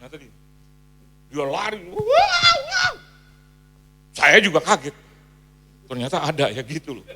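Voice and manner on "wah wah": high piercing shrieks; imitated wild shriek; woman's scream. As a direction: scream like someone seized. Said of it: "dia teriak".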